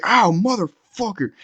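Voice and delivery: high, drawn-out cursing in pain; mock pained yelp. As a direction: imitate someone cursing a smashed fist. Acts out man hurting his fist.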